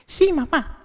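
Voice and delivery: put-on high, small voice of a girl of thirteen; obedient young girl. Thirteen-year-old answers dying mother. (niña de trece años responde a su madre moribunda, con voz aguda y sumisa)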